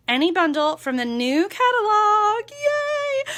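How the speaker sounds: bright cheer rising to a squeal; excited. Real, not acted. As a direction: cheer it like great news. Announcing a free bundle promotion.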